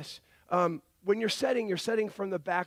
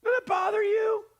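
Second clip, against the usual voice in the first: much higher, louder, shouted disbelief; incredulous outburst; 13 semitones higher, 5 dB louder than usual. Real, not acted. Pastor challenging his congregation, incredulous shout. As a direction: fling the question at the room in astonishment.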